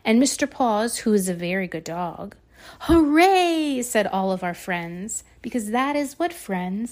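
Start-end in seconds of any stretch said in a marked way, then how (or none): 2.9-3.8 excited cheer. Acted. Friends cheer.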